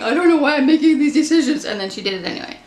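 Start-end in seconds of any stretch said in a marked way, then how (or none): 0.0-1.6 high, loud mock whine; whiny. Book reviewer mocking the main character's repeated complaint, whiny. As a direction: imitate a whiny heroine complaining. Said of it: "main character was super whiny".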